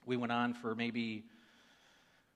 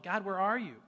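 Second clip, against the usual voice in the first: retold pleading cry; 8 semitones higher, 6 dB louder than usual. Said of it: "I'm like".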